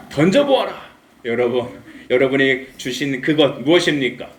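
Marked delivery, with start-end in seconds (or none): 0.1-0.9 mock-stern God insisting over the objection; insistent divine command. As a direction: overrule the objection with a raised voice. God's command repeated after protest.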